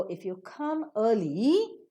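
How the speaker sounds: slow, "early" drawn out and climbing; rise on early. Shown as a demonstration. Teacher sums up the incomplete utterance, rising on "early". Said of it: "you raise up".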